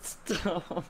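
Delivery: high, drawn-out giggly plea; slow squeal while cracking up; laughing plea. Real, not acted. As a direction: beg through helpless laughter. Begging while in a laughing fit.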